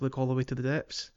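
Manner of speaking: ordinary talk, nothing marked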